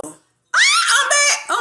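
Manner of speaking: high, loud laughing shout; relieved laughing shout back on stream. Real, not acted. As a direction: cheer your return with a laugh. Reconnecting on live stream.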